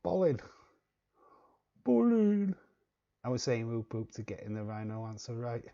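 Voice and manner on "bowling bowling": "bowling" and "Bowling" squeaked very high and loud; mocking pronunciation. Host mimics the guest saying "Bowling", high and loud. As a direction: parrot "Bowling" up high, teasing. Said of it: "you're saying".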